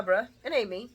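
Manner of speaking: ordinary talk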